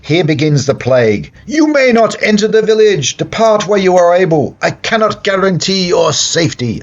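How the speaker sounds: high, loud warning with a Scottish tinge; accidental Scottish accent. Acted. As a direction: proclaim it as a Scottish-sounding plague doctor. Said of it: "I don't know why I sounded Scottish then".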